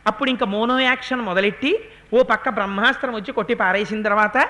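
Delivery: ordinary talk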